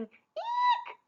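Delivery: very high squeal; shriek. A narrator's reading. Rella fleeing at sunset, very high shriek. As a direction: shriek in panic.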